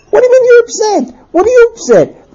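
high-pitched, indignant husband; incredulous exasperation. Acted. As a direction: squeal it in baffled protest. Husband incredulous at upset wife.

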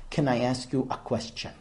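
low, quiet imitation of a Russian accent. Huge stranger approaches a newlywed couple, mild Russian accent. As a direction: quietly imitate a deep-voiced Russian man. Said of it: "comes over with a Russian accent and says".

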